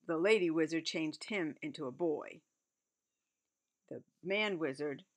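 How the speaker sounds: ordinary delivery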